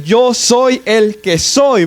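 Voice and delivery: very slow, weighty words; slow solemn God voice. Acted. Preacher voicing God to the church, drawn out and solemn. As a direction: let each word land like God naming himself.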